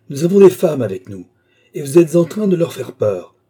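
high, loud reproach; indignant shouting. A narrator's reading. Officer rebukes worker for frightening the women, indignant shouting. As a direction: scold him at full voice. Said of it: "cria l'officier".

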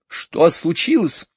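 high, slow irritated question; snapping at the boy; high cross mother. A narrator's reading. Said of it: "рассердилась миссис Банкс".

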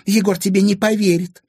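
high, loud, hostile retort; defiant retort. A narrator's reading. Anfisa defying Timofey's threat, hostile. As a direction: throw it back at him forcefully.

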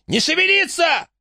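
high, panicked yell. Acted. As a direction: scream orders at them. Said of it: "заорал он".

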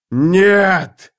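a full-throated roaring "no". Acted. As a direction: roar it out in despair. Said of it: "заревел Драконус".

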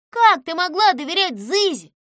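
loud shocked question; amazed at such trust; astonished. A narrator's reading. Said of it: "удивилась Бряка".

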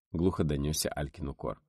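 usual speaking voice

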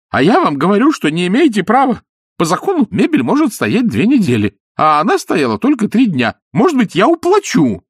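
loud high fussing voice; high pleading protest. Acted. Изнуренков pleading with Ostap on the stairs, high. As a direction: chase him pleading loudly.

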